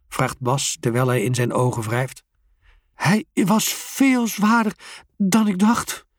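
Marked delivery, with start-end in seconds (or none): 2.9-6.1 slow, winded puffing words. A narrator's reading. Geert puffs after the heavy bike wins. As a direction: huff it out after the struggle. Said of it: "puft Geert".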